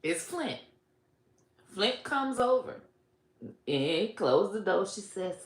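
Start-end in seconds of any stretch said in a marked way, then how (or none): none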